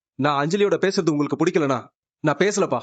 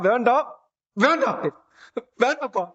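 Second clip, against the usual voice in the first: breaking into crying; 5 semitones higher than usual. Acted. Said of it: "அழுவாதண்ணா".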